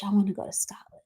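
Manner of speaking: breathy whispered longing to travel; wistful whisper. Real, not acted. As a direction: whisper it like a daydream. Wistful aside about travelling abroad.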